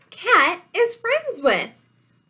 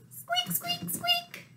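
tiny, very high, soft squeaks; high-pitched, quiet mousy squeaking; mouse squeaks; 11 semitones higher, 12 dB quieter than usual. Acted. Voicing Mouse the Mouse.

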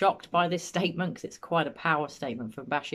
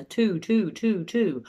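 stuttering demo; 4 semitones higher, 4 dB louder, much slower than usual. Shown as a demonstration. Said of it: "stuttering over I".

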